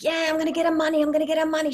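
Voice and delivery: high, fast, eager chatter; excited puppy. Acted. Puppy racing to get her money, excited. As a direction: gabble it like a thrilled puppy. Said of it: "She's, like, excited".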